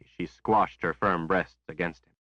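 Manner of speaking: ordinary delivery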